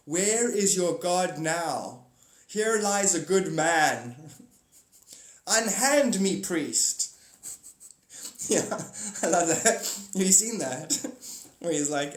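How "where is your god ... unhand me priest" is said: mock dramatic proclamation. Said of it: "Tell them".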